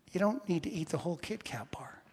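soft, near-whispered aside; quietly nudge, almost whispering; hushed gentle reproof. Acted. Wife quietly correcting the preacher about snacking, hushed.

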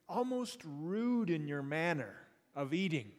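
normal talking voice

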